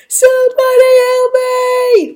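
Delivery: very high, loud, drawn-out scream; frantic high-pitched yell; screaming for help. A narrator's reading. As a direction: scream out in panic. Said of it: "Montu screamed as he ran".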